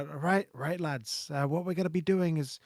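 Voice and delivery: a hint of a flat Liverpool manager; Scouse accent. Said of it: "it's my Scouse accent".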